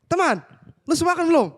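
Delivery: high-pitched call to a friend; rough-sounding greeting. Retold or imitated. Retelling how he greeted a friend who felt scolded, high rough-sounding call. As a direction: imitate hailing a friend in a high voice. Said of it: "tadi lu kasar banget".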